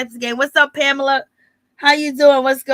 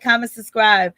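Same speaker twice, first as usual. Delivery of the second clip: ordinary talk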